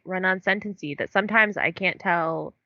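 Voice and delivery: ordinary talk, nothing marked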